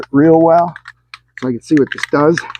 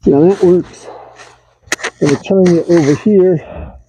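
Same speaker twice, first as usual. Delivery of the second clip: speaking through a respirator mask; words muffled behind a respirator; 4 semitones higher than usual. Real, not acted. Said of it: "Now I'll be a little muffled with the respirator on".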